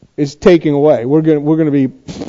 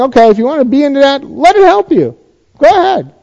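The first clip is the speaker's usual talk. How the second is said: sarcastic God; 8 semitones higher than usual. Retold or imitated. God mocking Babylon's sorcery, sarcastic and much higher. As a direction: egg them on with biting sarcasm. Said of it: "this is where the sarcasm comes in".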